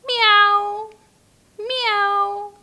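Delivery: cartoon kitty meow; cat meow. Shown as a demonstration. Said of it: "A cat".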